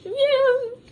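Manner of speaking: high, loud mock-eager yeah; sarcastic yeah. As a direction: squeal out a fake-keen yes. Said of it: "And I was like".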